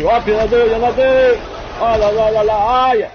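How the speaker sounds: high, loud alarmed yelling; panicked yelling. Real, not acted. Gamer cornered by two enemies, high panicked yelling. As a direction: shout in real alarm. Enemies rush him in game.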